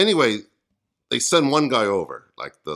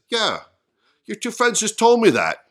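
raised-pitch, louder retort as Peter; joke punchline; 8 semitones higher, 6 dB louder than usual. Acted. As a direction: fire the punchline back, high and loud. Punchline first delivered in Peter's voice.

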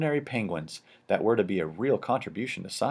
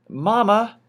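a much higher, louder call of Mama!; joyful shout; 13 semitones higher, 8 dB louder than usual. A narrator's reading. Popper calling up to his wife, a high loud shout. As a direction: call out across the gangplank. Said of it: "shouted Mister Popper".

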